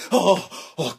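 ordinary talk

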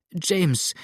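high, imploring cry of his name; desperate pleading. A narrator's reading. Adele begging James to flee, desperate pleading. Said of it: "flehte Adele jetzt in wilder Verzweiflung".

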